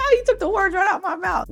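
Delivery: high laughing aside; amused high-pitched remark. Real, not acted. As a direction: laugh through the line.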